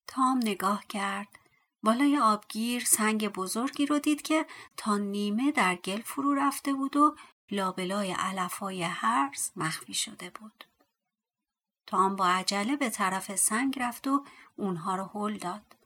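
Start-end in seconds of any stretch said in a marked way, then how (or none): none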